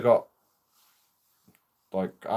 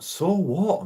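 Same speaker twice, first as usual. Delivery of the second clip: a sharp, higher "so what" thrown back; defiant retort; 7 semitones higher than usual. Retold or imitated. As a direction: shrug off the accusation with a challenge.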